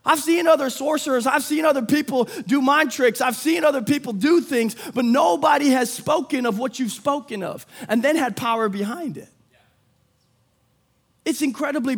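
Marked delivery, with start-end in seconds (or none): 0.1-9.3 louder, higher preaching build with shouted peaks; full-force preaching. Acted. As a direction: drive it home to the congregation. Preaching climax with music.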